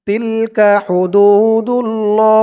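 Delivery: slow, drawn-out recitation of Tilka হুদুদুল্লাহ; recited verse. Shown as a demonstration. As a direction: chant Tilka হুদুদুল্লাহ slowly with long vowels. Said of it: "বলুন আমার সাথে". (ধীর সুরে তিলকা হুদুদুল্লাহ তিলাওয়াত)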